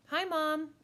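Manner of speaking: high, loud hello; calling greeting. Acted. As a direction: call out to Mom. Boy greets mother in park.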